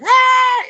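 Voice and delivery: high, full-blast imitated yell; wordless shout mimicking a very loud man. Imitating the loud Italian restaurant owner, high blasting yell. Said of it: "he was real loud".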